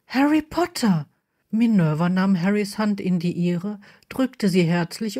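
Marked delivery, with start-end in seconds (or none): 0.1-1.1 high, delighted hail; pleased exclamation, pitched up; delighted greeting. A narrator's reading. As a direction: greet a welcome guest with a high, rising-then-falling call. Headmistress warmly greets Harry on arrival.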